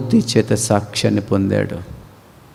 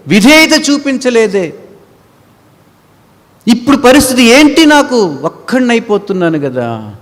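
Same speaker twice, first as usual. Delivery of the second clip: higher, louder acted despair; wailing lament; 11 semitones higher, 6 dB louder than usual. Man left behind wailing over his unbelief, acted despair. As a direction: cry out in regret.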